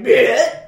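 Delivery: mock retch at the toast; retching noise. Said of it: "was like".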